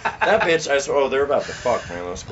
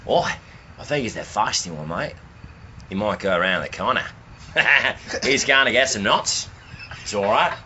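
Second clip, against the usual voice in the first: put-on Australian accent. Friend narrating backyard squirrels for the podcast, in a mock Australian accent. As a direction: narrate the squirrels like an Aussie wildlife host. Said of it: "fucking Australian accent".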